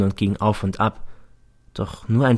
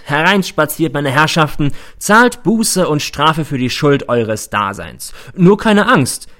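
loud, higher mock barker pitch; fairground barker; 5 semitones higher, 4 dB louder, slower than usual. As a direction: hawk to the crowd like a showman. Fairground barker role, imagined.

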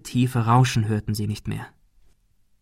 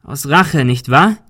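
louder, challenging question; calling out; 3 semitones higher, 6 dB louder, slower than usual. A narrator's reading. Ben confronting the man in the fog, calling out. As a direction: call the accusation across to him. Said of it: "rief Ben".

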